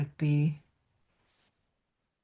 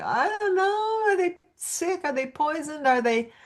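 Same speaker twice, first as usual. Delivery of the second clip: much higher, anxious fretting owner; worried owner; 7 semitones higher than usual. Retold or imitated. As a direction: fret over a sick pet. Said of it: "It's like".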